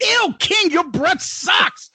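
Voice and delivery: high, disgusted "ew" put-down; mocking schoolgirl. Girl rejecting the goofy teacher, high mocking disgust. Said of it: "and the girl's like".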